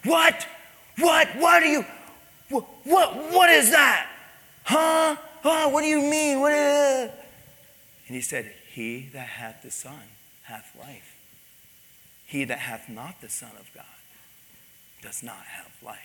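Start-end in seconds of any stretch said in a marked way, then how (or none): 0.0-7.1 high, loud sputtering outrage; furious screaming. Acted. Husband shouting over the pastor's calm answer, furious. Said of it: "was violent and screaming". 8.7-16.0 hushed, low, deliberate recitation; calm. Retold or imitated. Pastor quietly holding his ground with scripture, calm. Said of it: "I just said calmly".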